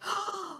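sharp high intake of breath; excited gasp. Retold or imitated. Astronomer seeing a spectrum, excited gasp. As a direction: gasp in delight. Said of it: "it's like".

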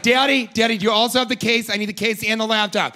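high, loud nagging daughter; whiny daughter. Acted. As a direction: nag dad like a demanding child. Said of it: "she's like".

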